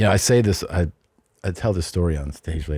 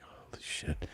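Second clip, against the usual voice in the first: breathless whispered horror; whispered dread; 4 semitones lower, 21 dB quieter, slower than usual. Retold or imitated. To himself beside the woman, a horrified whisper. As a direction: hush it out, appalled. Realizing what he'd done.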